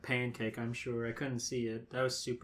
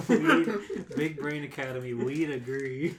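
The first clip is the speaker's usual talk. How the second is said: laughing; 2 semitones higher, 8 dB louder than usual. Real, not acted. Laughing at the joke title.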